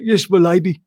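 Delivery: quick nod to Parker's "Yes, my lady"; lightly voiced butler line. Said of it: "the voice of Parker".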